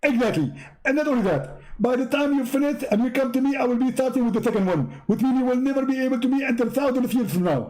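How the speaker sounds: high, fast gloating falsetto; smug high voice explaining to a visitor; Zakir Naik voice. Pretend. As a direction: gloat in a squeaky preacher voice.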